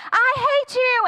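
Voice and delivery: mock TV yell. Divorcing couple fighting on TV, mock yell. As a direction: imitate a TV couple yelling, half-strength. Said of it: "people yelling on the T V".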